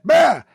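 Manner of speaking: high, loud "bah" holler; imitated shout. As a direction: bellow a wordless mock call. Re-enacts the shouted call.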